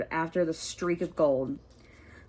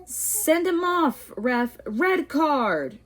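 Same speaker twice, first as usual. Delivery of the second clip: much higher, slow indignant yell; 9 semitones higher, 3 dB louder, slower than usual. Acted. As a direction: yell at the referee from the stands. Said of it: "Dean Thomas was yelling".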